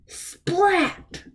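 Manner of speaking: high, sharp splat; splat sound. A narrator's reading. Reading to kids, the cow crashing into the waiter. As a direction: smack it out like food hitting.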